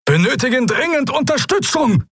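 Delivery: high, desperate shriek for help. A narrator's reading. Captain over the projection shrieks for urgent support. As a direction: shriek it over the battle noise. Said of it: "kreischte die Projektion".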